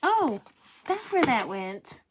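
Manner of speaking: high, loud little discovery; oh, found it; surprised oh. Real, not acted. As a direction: pleasantly caught off guard. Finds missing item, surprised.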